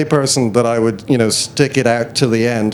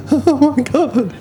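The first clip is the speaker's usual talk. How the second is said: laughing gasp; 11 semitones higher, slower than usual. Retold or imitated. Retelling meeting his idol, laughing.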